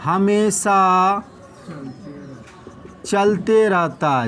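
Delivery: slow, with long pauses; slow dictation. Shown as a demonstration. Teacher dictating to students, slow and paused. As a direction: hand it over word by word. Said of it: "लिख दें यहाँ रे".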